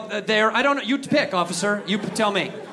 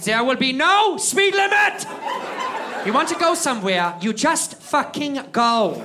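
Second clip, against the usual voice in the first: dickhead leader roaring; 6 semitones higher, slower than usual. Retold or imitated. Big leader tells the room in a booming shout. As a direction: roar it like the big boss laying down law. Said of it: "he was just like".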